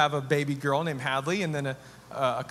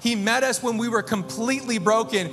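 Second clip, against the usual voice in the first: rising sermon passion; 7 semitones higher than usual. Acted. Pastor to his congregation, preaching with rising fervor.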